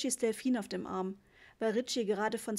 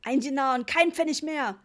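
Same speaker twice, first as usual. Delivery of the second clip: loud, higher angry refusal; forceful irate shout; roaring dad; 4 semitones higher, 8 dB louder than usual. A narrator's reading. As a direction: bellow the final offer in fury. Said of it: "brüllte Papa".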